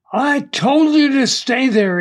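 high, insistent telling-off; angry reprimand. A narrator's reading. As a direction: scold a man who disobeyed. Big man reprimands fallen man.

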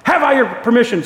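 high, loud insistent question; acted angel pressing hard; forceful demand. Angel demands consent more forcefully.

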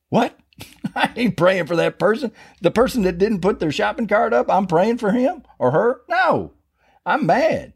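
high-pitched mock indignation; mad, indignant protest. Preacher arguing with the thought in his head, comically outraged. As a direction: balk in hammed-up disbelief, voice shot way up.

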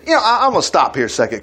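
ordinary delivery

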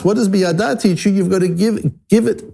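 usual speaking voice

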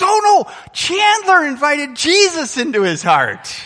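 put-on high, loud eager kid correcting his dad; excited little boy. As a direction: squeal it like a thrilled child. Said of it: "He goes".

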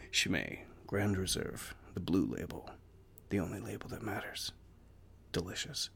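low, soft, relishing murmur; quiet savouring aside. Real, not acted. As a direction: savour the beer name softly. Savouring his beer aside.

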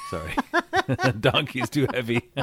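high, loud laughing apology. Real, not acted. Host cracking up over donkey yoga, laughing. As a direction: laugh through the words. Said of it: "Oh my God".

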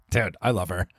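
ordinary talk, nothing marked

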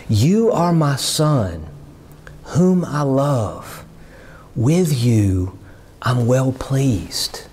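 slow, gentle words of a loving father; tender fatherly blessing. Retold or imitated. Preacher voicing the Father's blessing.

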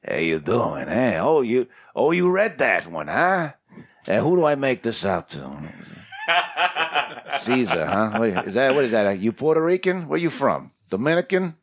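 put-on gruff old-timer New York voice; old New York accent. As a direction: imitate an old Queens comic creator. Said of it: "they all have that same sort of".

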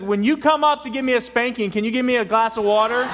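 put-on high, loud kid voice; cheeky boy. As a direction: call out the punchline like a child. Boy's cheeky punchline to mother.

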